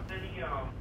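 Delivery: faint, far from the phone; speaking away from the speakerphone; phone held away. Real, not acted. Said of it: "you have to talk into the phone".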